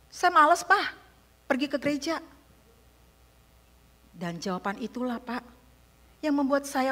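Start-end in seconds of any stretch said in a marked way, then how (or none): 0.1-2.2 high, loud voice of a defiant son; sulky son. Retold or imitated. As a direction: whine it back like a grumpy teenager. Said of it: "anak saya bilang". (anak yang ketus, suara tinggi dan keras)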